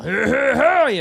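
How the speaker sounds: loud, laughing hype intro; excited greeting. Acted. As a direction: burst in loud like a showman. Loud hammy show opener.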